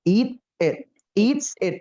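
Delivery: emphatic teacher drill; contrast drill. Shown as a demonstration. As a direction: drill "Eat it" with extra force. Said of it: "Beda loh".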